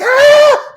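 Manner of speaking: loud, very high fan shriek; imitated crying scream. Comedian imitating a fan who meets him, screaming. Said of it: "she starts screaming and crying".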